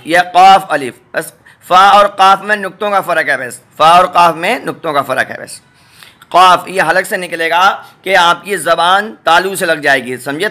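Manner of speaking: usual speaking voice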